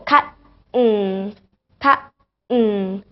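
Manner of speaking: "Cut" stopped short, pause, then hummed "mm". Shown as a demonstration. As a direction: split it: Cut... mm. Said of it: "speaking in with T only".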